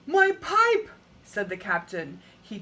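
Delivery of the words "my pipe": high, loud gasping alarm; startled cry. Acted. Captain realises his pipe is lit, startled loud cry. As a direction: yelp it, suddenly alarmed.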